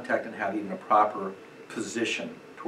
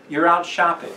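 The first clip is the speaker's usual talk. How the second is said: ordinary talk, nothing marked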